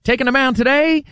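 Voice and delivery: lightly voiced old-time ballplayer; old-timey ballplayer voice. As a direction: play an old-timey ballplayer introducing himself. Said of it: "somebody that talked like this".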